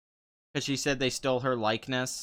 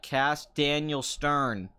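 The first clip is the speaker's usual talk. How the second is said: slow, deliberate, every word stressed; very clearly; much slower than usual. Shown as a demonstration. Addressing Hollywood directly, slow and emphatic. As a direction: spell it out slowly for Hollywood. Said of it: "Let me say this very clearly".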